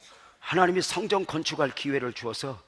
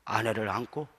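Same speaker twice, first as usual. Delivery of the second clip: usual speaking voice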